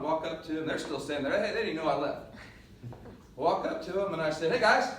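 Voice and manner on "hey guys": loud, higher greeting; cheery call-out. Acted. Hiker strolling up to his underdressed friends, cheery call-out. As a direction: call out brightly to a group, loud and high.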